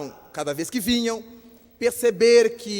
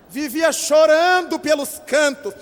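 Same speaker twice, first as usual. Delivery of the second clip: ordinary talk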